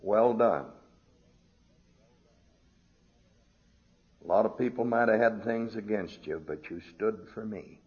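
softly, like a kind master praising; gentle voice of Christ. Retold or imitated. Preacher lightly voicing Christ's praise, soft. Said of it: "I expect to hear him say".

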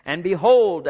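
loud, sudden announcement; dramatic. Acted. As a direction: proclaim it boldly. Dramatic scripture reading.

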